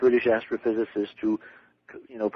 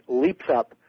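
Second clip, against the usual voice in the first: ordinary talk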